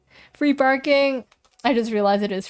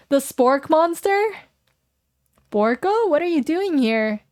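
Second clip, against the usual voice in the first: surprised; 6 semitones higher than usual. Acted. Surprised at the apparition.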